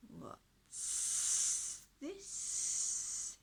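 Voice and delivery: high, hissing dragon voice. A narrator's reading. Dragon finds a knight in its dungeon, hissing. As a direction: hiss it like a suspicious dragon. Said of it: "hissed the dragon".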